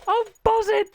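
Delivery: high, loud excited squeal; giddy high-pitched outburst; buzzing with excitement. Pretend. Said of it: "I am so excited for this".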